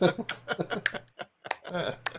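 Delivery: laughing through the words; can't hold back a laugh. Real, not acted. Host laughing at his own mix-up on air, laughing.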